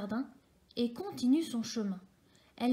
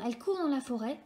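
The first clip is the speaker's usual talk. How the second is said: ordinary delivery, nothing marked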